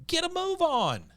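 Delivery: high, slow, drawn-out announcer read; mock announcer. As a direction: ham up the rule like a host. Reading rule in mock announcer voice.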